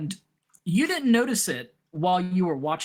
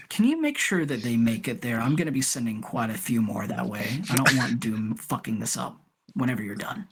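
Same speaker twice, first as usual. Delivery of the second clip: lower, dry commanding; Tyment's voice; 8 semitones lower than usual. Acted. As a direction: give the order flatly. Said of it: "he goes".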